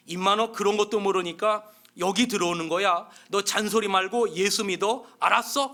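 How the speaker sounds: loud bullying command, pitch up on 알았어; gruff order. Acted. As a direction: order him to believe or else. Inmate bullying newcomer to believe.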